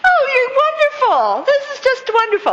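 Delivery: real delighted laughing praise. Real, not acted. Teacher delighted after class performs.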